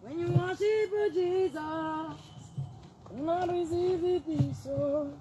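sing-song blessing. Real, not acted. Slow blessing after the praise.

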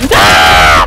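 loud, low-pitched yell; frustrated scream. Real, not acted. Player who hates sand, loud low scream. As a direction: let out a real roar of frustration.

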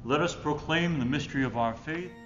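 ordinary talk, nothing marked

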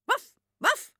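high yappy woof woof; dog barking. A narrator's reading. Narrator barking as the dog for the children. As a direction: bark it out like a small excited dog. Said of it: "zegt Takkie".

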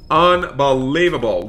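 usual speaking voice

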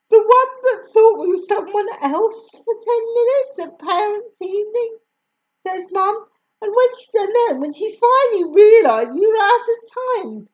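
lightly voiced sarcastic mum; scornful mum. A narrator's reading. Mum mocking the teacher.

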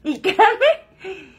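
slow words through real laughter; real laughter over the opening words; laughing. Real, not acted. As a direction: laugh while getting the words out. Laughing at her own story.

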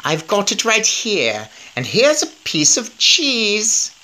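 high, slow witch cackle-voice; high slow sly witch voice. Acted. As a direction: offer the trap like a sly witch. A witch answers the Grand High Witch.

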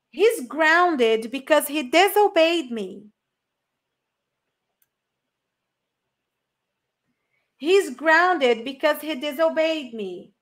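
slow, clear English model; slow model sentence. Shown as a demonstration. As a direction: enunciate slowly. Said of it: "Listen and repeat". (frase modelo lenta em inglês)

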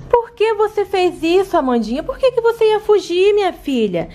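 low reassuring mom; mother voice. Acted. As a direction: soothe the little one as mom. Mother questions runaway baby.

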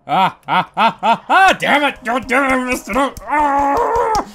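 high, loud laughing cries; laughing panic. Real, not acted. Player laughing in panic after missing the shot. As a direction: laugh and shout while fumbling.

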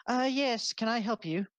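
high, polite temple clerk; polite cleric woman. Acted. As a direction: greet them brightly and helpfully. Cleric woman greets visitors.

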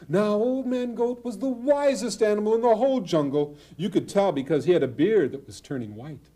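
ordinary delivery

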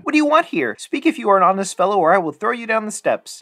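high, loud fast warning; crying out. A narrator's reading. Boy warning the silent figure a second time, loud. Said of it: "The boy cried a second time".

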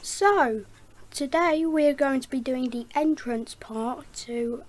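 loud, booming echo voice. Shown as a demonstration. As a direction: boom it out like it echoes off canyon walls. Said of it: "I'll start with the echo voice".

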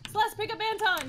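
high, urgent shout to a teammate; urgent call out. Real, not acted. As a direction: call it out in a panic.

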